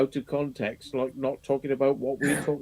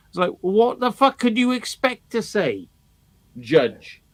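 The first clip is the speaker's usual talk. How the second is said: ordinary delivery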